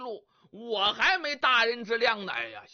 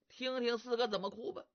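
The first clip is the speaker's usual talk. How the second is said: ordinary delivery, nothing marked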